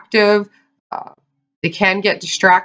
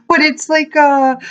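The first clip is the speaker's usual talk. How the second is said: usual speaking voice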